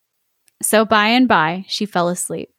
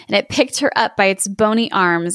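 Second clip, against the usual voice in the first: plain reading voice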